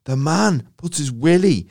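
high and loud, then slowing for the big reveal; excited kid. Retold or imitated. Schoolboy spilling the shocking secret, high and eager. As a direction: lean in conspiratorially like a thrilled schoolboy.

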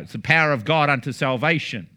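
usual speaking voice

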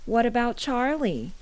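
loud, high-pitched yell. A narrator's reading. Brother yells back to his sister from beyond the fence. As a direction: call it out loudly from a distance. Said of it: "he yelled".

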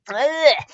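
high groan of exasperation; exasperated groan. Real, not acted. Reader fed up with a misprint, exasperated groan. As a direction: groan at a silly mistake.

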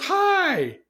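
put-on breezy, confident greeting to old friends; acting all blue. Girl greeting old friends with showy confidence. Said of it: "acting all blue".

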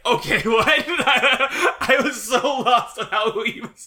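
high, loud words breaking into laughter; laughing confusion. Real, not acted. Co-host admitting being lost at the arena scene, laughing confusion. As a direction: laugh helplessly through the words.

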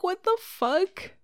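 high, slowed what the fuck; replaying the shock, pitch shooting up; retelling the moment the subs landed, shocked; shocked disbelief. Reacting to 100 gifted subs.

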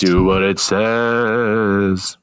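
slow eerie ghostly voice; spooky drawn-out ghost moan. Pretend. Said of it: "I made that sound like a ghost".

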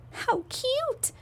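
very high, soft coo. Acted. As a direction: melt over something adorable. Crowd coos at dancing bears.

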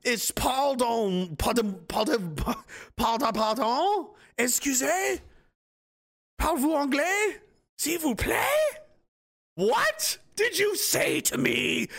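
high, slow bewildered questions; mock confused old man. Old man mishearing an insult, high slow mock bewilderment. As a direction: act baffled, mangling French.

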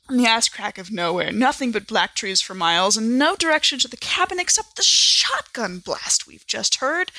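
plain reading voice

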